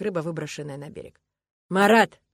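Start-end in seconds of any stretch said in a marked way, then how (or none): 1.7-2.2 much louder call; the coach shouts after Marat; shouting after someone. Acted. Loud call. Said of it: "кричит он мне вслед".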